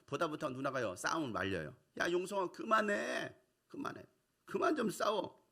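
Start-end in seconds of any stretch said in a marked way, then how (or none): 2.0-5.4 raised voice. Retold or imitated. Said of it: "그 말은 굉장히 언성 높이".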